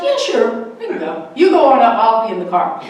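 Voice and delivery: breezy offer, loud on you go on up; cheerfully volunteering the candidate while she stays put; mock brave driver. Driver teasing the candidate, loud and breezy. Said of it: "Janice is like".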